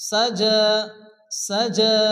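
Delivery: সাজা ending on a pure long "aa", no "y"; measured tajweed সাজা with drawn-out ending; silent final letter. Shown as a demonstration. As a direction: hold the end of সাজা, leaving the written ya silent. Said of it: "লেখা থাকবে উচ্চারণ হবে না".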